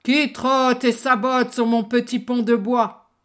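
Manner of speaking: loud booming troll. Acted. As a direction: boom it like a troll. Troll challenges big goat.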